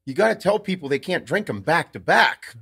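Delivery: caffeinated warning, retold with gusto; jacked up. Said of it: "like, a little jacked up".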